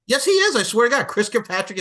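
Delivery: high, loud insistence; insistent exclaim. Real, not acted. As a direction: insist hard, voice jumping up. Insisting in an argument.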